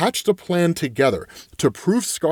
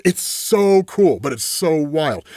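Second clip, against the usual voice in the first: excited; slower than usual. Real, not acted. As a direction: savour the word so. Enthusing about the story.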